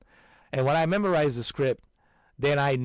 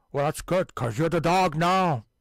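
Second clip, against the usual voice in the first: mock Scottish voice; 5 semitones higher, 6 dB louder than usual. Answers as Connery voice.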